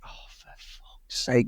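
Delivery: slow, whispered grumble of disbelief; exasperated whisper. Retold or imitated. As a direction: breathe it out slowly, fed up. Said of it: "And I'm like".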